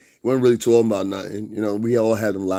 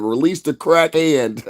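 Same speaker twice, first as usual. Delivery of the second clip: misheard quote; 6 semitones higher, 4 dB louder, slower than usual. Retold or imitated. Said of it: "I thought she said".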